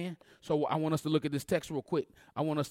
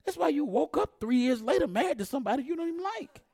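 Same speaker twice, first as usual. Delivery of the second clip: higher-pitched preacher cadence, repeating that's why; preaching refrain; 13 semitones higher, 3 dB louder than usual. Acted. Preacher to congregation, higher rhythmic preaching. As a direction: drive each that's why home to the crowd.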